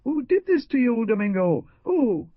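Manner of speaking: high, loud panicked crying out; frantic cry. A narrator's reading. Wife over her bleeding husband, panicked high cry. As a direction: shriek the question at the wounded husband.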